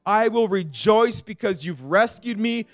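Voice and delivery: high, loud, impassioned sermon delivery; full-force preaching. Acted. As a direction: preach it hard, pleading with the congregation.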